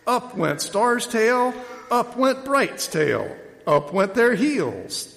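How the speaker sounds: slow, high storytelling build; suspenseful narration. A narrator's reading. Reader telling how the calves' tails went up, suspenseful. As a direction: draw out each phrase for suspense.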